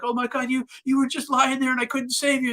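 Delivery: high-pitched, distraught retelling; imitated crying friend. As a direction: play the friend wailing, without extra volume. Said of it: "he's screaming and he's crying".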